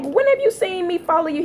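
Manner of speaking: high, disbelieving question; incredulous. Retold or imitated. Woman retelling her reply to ex's accusation, incredulous and high. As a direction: ask it like it's absurd.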